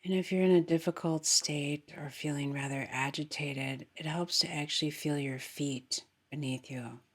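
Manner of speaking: soft, unhurried meditation voice; guided meditation. Acted. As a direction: guide them gently into the breath.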